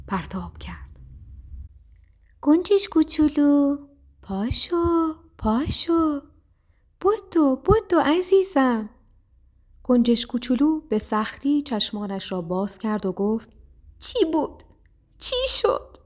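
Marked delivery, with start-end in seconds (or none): 2.4-8.9 high, urgent coaxing voice; anxious little mouse. A narrator's reading. Mouse urges fallen sparrow to rise. 14.0-16.0 high, small bewildered voice; dazed little sparrow. A narrator's reading. Dazed sparrow comes round.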